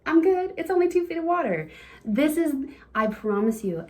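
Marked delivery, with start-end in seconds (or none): 0.1-1.7 put-on high, quick, sheepish kid voice; mock childlike relief; relieved little boy. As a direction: play the kid realizing he's fine. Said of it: "He's like".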